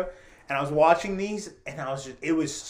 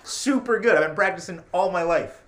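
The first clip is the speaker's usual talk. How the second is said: mock boast; 2 semitones higher, 6 dB louder than usual. Joking to friends about his English, mock boast. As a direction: puff up in fake pride.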